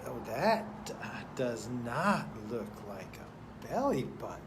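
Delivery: ordinary talk, nothing marked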